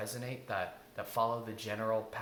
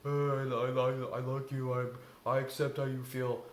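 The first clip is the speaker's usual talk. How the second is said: flat rote reading; 4 dB louder than usual. Shown as a demonstration. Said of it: "just reading them off a note card".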